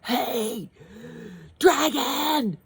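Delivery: high, loud shout into his ear. A narrator's reading. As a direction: yell right into his ear. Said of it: "shouted as loud as she could".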